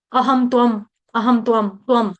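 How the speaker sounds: त्वम with a half त blended straight into व. Shown as a demonstration. Teacher shows the half त in त्वम. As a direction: say त्वम as one quick cluster. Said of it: "यहाँ पे त आधा है".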